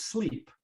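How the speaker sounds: sleep with a stretched ee; long vowel in sleep. Shown as a demonstration. Teacher showing learners the long vowel in sleep. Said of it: "Slip, sleep".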